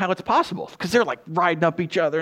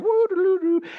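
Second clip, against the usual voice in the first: high, airy doo-doo tootling; carefree mock humming; 13 semitones higher than usual. Imitating unstressed mom crossing traffic, carefree high tootling. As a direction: imitate someone blithely singing to herself. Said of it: "the mom's not stressed at all".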